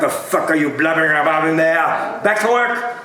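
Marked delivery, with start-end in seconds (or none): 2.2-3.0 sharp order. Acted. Scrooge orders clerk.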